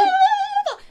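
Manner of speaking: high, loud laughing mock whine; bratty daughter. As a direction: mimic a little girl bitching at mom. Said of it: "the daughter was fucking bitching at the mom".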